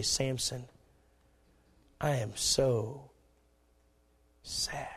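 hushed, slow lament with long pauses; sorrowful. Acted. Preacher grieving over Samson's life, hushed and sorrowful. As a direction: let it fade almost to a whisper. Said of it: "I look at Samson's life and I say".

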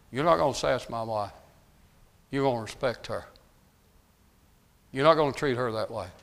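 ordinary talk, nothing marked

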